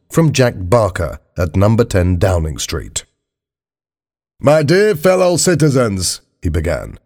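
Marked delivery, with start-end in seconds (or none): 4.4-6.2 high-pitched politician addressing the nation; formal political broadcast, pitched high; Prime Minister's address. Acted. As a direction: open a public announcement in a raised voice.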